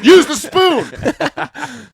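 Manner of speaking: put-on high, very slow old lady nag; hint of a strict grandma; grandma scolding. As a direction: drag it out like a fussy grandma. Imitating grandma's house rules.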